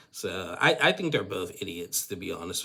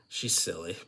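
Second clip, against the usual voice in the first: giggling through the words; laughing; 4 semitones higher, 4 dB louder than usual. Real, not acted. Amused vlogger laughing about Tiger Lily.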